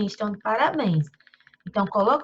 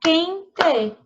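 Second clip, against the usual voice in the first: QUENTE as two beats, QUEN-TE, pitched up; higher, separated "quen-te"; split into syllables; 6 semitones higher, 4 dB louder than usual. Shown as a demonstration. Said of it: "duas sílabas".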